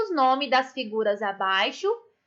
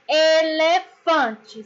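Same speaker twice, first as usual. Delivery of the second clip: ELEFANTE very slowly by syllables, weight on FAN; stress on FAN; much slower than usual. Shown as a demonstration. Teacher showing pupils the tonic syllable, slow ELEFANTE with stress on FAN. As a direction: sound out E-LE-FAN-TE, leaning on FAN. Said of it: "sílaba tônica".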